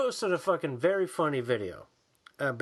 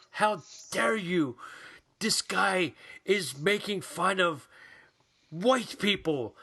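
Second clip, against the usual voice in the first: slow, higher-pitched imitation of indignation; mock outrage; 4 semitones higher, slower than usual. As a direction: spell out the grievance, hammed-up and scandalized. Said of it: "he is mad".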